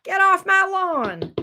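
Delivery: high, slow mock holler; grouchy Capricorn. Reader mocking the client as a Capricorn, grouchy holler. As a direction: drag out a cranky high-pitched complaint. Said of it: "You sound like the damn Capricorn".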